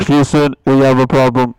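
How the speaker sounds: loud astronaut distress call; mayday radio call. Pretend. Pilot bit continues.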